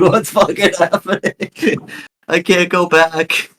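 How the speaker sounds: high, drawn-out panicked cartoon voice; Morty. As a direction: squeal it slowly in rising distress. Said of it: "I felt like Morty".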